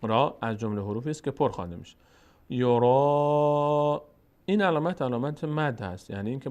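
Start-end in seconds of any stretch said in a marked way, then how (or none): 2.5-4.0 "یورا" with a long, louder held aa; elongated madd. Shown as a demonstration. Teacher demonstrates the madd sign, elongated vowel. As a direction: slowly stretch the last vowel of "یورا". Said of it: "بکشیم این حرف رو".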